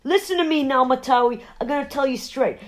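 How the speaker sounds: hammed-up husband's ultimatum; stern husband. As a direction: lay down the law to the wife. Pete warning wife in skit.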